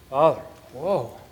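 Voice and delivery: lightly voiced bragging son, showing off; a hint of a boastful son parading his deeds; mock boastful son. Preacher voicing the son boasting to father, lightly mocking. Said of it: "Don't ever go there".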